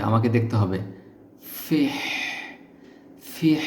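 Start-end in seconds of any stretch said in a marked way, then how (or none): 1.6-3.6 ফিহ ending in an airy h from the throat; throaty h demo. Shown as a demonstration. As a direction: let only breath leave the throat on ফিহ. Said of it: "খেয়াল করতে হবে". (গলা দিয়ে বাতাস বের করে ফিহ)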